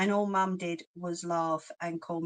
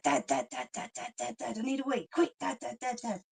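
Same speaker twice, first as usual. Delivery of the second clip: urgent dog voice; 4 semitones higher than usual. Retold or imitated. Dog voiced begging dad.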